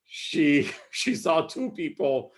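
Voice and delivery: amused words broken by chuckles; laughing while talking. Real, not acted. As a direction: chuckle through the retelling. Amused while retelling the joke.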